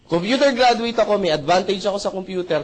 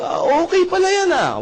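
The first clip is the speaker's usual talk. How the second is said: mock surprise; 12 semitones higher, 3 dB louder than usual.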